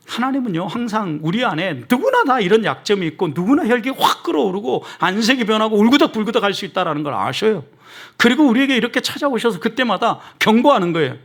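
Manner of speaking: high-pitched, urgent sermon flow; impassioned pulpit exhortation; forceful preaching. Real, not acted. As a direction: press the point on the congregation.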